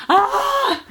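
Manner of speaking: sudden high exclamation; surprised yelp. Real, not acted. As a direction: yelp as if you forgot something. Sudden realisation mid-vlog.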